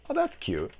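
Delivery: high, airy mock-polite "Oh, that's cute"; unimpressed "that's cute". Reacting to every joke in this movie, mock-polite and unimpressed. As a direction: pat the joke on the head without laughing. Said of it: "It's more like".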